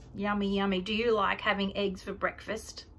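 plain reading voice

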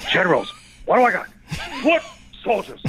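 hammed-up yelling guy; Al Pacino. Comic imitates Dick Tracy Pacino, mock yelling. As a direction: bursts of mock yelling, jumping in pitch. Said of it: "The yelling, the yelling guy".

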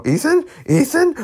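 high-pitched excited name call; surprised recognition. Real, not acted. Spots a familiar character, calls out.

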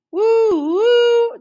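high, loud "woo" train whistle; sustained, high-pitched train hoot. A narrator's reading. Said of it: "the train whistled".

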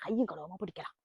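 sneaking into the house to catch him; sneaky hush. Acted. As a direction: keep it hushed as you creep in. Sneaking in to break the lock.

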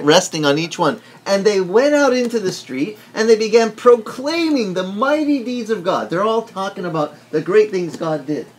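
usual speaking voice

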